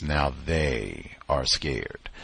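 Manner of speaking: slow line with a long falling "they"; stress on THEY. Shown as a demonstration. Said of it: "for emphasis".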